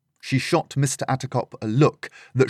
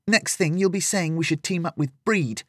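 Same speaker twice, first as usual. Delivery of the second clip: put-on higher girl's voice with a sulky edge; young Lillian, sarcastic; 7 semitones higher than usual. Lillian sulks at the adults, higher and sarcastic. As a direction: toss it off sarcastically, voice lifted.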